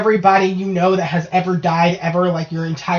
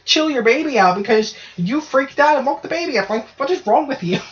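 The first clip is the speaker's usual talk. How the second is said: retold scolding; 6 semitones higher than usual. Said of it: "I'm like".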